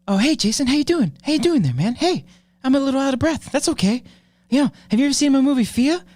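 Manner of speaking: hammed-up Mark Wahlberg, chatty and out of breath; Mark Wahlberg impression. Doing his Mark Wahlberg for the stream chat, high and hammy. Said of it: "I had a Mark Wahlberg".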